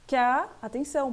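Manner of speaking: normal talking voice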